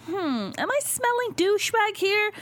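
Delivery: high, mock-suspicious musing; suspicious sniffing. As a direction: sniff out a douchebag, teasing. Suspicious inner voice reading profile.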